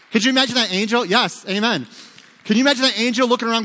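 excited preaching. Real, not acted. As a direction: fire up the congregation. Preacher building to climax.

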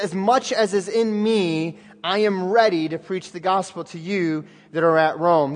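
drawn out, weighing each word; preacher slowing to land the verse; slow emphatic reread. Shown as a demonstration. Preacher rereading the verse slowly to his congregation, drawn out. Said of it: "He says".

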